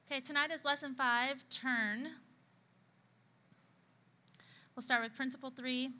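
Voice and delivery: teacher voice. Real, not acted. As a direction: address the class like a teacher. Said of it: "Turn on my teacher voice".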